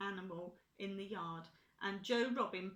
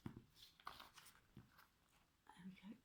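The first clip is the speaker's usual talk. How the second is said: whisper; 20 dB quieter, much faster than usual. Real, not acted. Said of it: "aside while reading".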